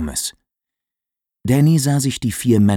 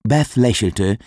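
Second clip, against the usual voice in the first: plain reading voice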